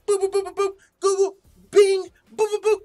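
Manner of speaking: high loud boop boop sound effects; silly high-pitched beeps; typing noises. Pretend. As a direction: mime frantic googling. Mimicking Googling sounds.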